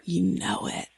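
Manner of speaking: playful whispered reply in character; spooky whisper. Pretend. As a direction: keep the ghost story whisper going. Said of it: "Are you holding a flashlight under your chin".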